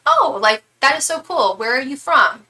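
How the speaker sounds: acted-out excited interest; delighted greeting. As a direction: gush as if meeting one of your own. Acts out greeting fellow British people.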